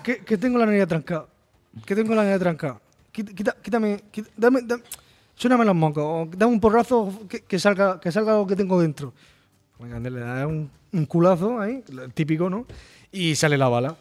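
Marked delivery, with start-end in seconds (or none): 0.0-9.1 higher, whining cannon with a blocked nose; whiny stuffed-up cannon. Retold or imitated. Retelling the game to a friend, a higher whiny stuffed-up cannon voice.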